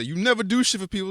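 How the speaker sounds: high, loud quoted complaint; mock complainers. Imitating people who attack good deeds, mock complaint. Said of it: "there's people that say".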